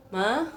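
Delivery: "Ma" gliding upward; second tone. Shown as a demonstration. Teacher shows the easy way to learn, "Ma" rising. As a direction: say "Ma" rising, like asking for mum. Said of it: "nadanya naik ke atas".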